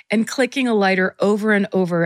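usual speaking voice

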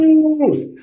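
YOUTH hollered long, high and loud; mimicking a wrestler's drawn-out battle cry of YOUTH; imitated wrestler yell. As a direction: stretch YOUTH into a ringing, high-pitched holler. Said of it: "what he was yelling was".

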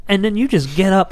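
ordinary talk, nothing marked

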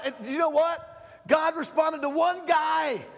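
slow, high, shouted preacher's punchline; full-force preaching. Acted. Preacher telling the church God answered, shouting slowly.

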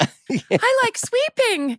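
slow squeaky falsetto; drawn-out fake-cheery squeak; panicky high voice. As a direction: stretch it out high and sing-song like it's fine. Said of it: "What's that internal voice?".

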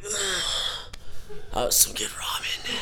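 unvoiced savoring whisper; whispered taunt. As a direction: rub it in under your breath. Taunting angry friend after eating.

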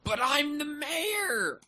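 high, slow, drawn-out silly voice; dinosaur voice. Pretend. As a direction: stretch it out in a goofy high voice. Proclaims in a put-on voice.

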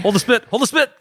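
high-pitched, rising plea; mock urgent call. Customer begging the kitchen to hold the spit, mock alarm.

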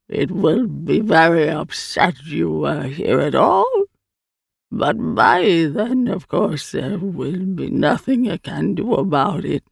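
slow, stretched alien delivery; strange creature, ominous. Acted. The Eye warns Hand about Tooth, slow creature voice. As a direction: draw the words out as an otherworldly being.

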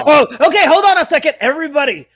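high, loud, laughing objection; excited interjection. Real, not acted. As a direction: break in loudly with amused alarm. Interrupts, amused and outraged.

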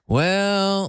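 well held long and high; drawn-out well. Shown as a demonstration. Man showing his crutch word to co-host, drawn out. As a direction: stretch the word well before answering. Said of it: "You, like, draw it out".

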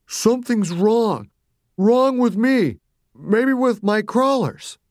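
high, slow, distressed pleading; a troubled mother confessing something is amiss; sad Mommy. A narrator's reading. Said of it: "Mommy seemed sad".

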